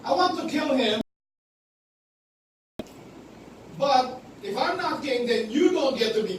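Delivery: loud, high raging father; angry Saul. Acted. Saul raging at Jonathan over the kingship, loud. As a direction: bark it at the son who threatens your throne.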